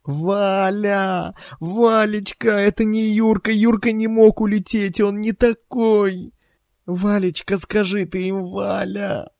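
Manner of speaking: very high, keening lament; hysterical wailing. A narrator's reading. Wife clings to her husband's knees, wailing hysterically. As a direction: wail and plead in desperate high pitch. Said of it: "истерическим воем".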